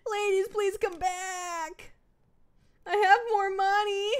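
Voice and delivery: high, slow hammed-up begging; mock pleading. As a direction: plead pathetically for them to return.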